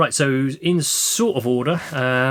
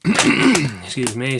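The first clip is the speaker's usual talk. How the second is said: hoarse, croaky voice; tired, croaky throat; 5 dB louder, much slower than usual. Real, not acted. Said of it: "sound croaky throat".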